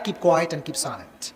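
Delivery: very slow, soft, drawn out word by word; slow quiet advice. Shown as a demonstration. Preacher to wives, very slow and soft. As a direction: lay each word down slowly and gently. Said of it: "teaching wives to stay quiet".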